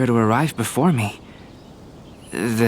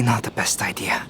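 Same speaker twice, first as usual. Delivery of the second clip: whispered aside. Acted. Rueful aside to himself.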